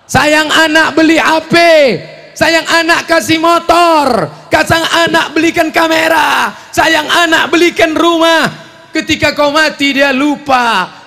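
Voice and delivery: high, loud fiery proclamation; preaching shout. Real, not acted. Preacher scolding doting parents, loud fiery preaching. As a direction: thunder each line at the crowd.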